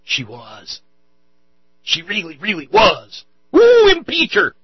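rising to a high, loud, slow hammed-up cheer; mock outrage. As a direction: camp it up like a gleeful zealot.

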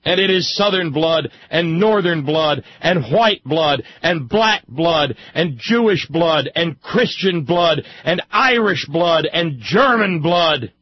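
loud, high speechifying; impassioned oratory. Real, not acted. Speaker orating on Civil War monuments and blood, impassioned. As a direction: drive every phrase home, loud and high.

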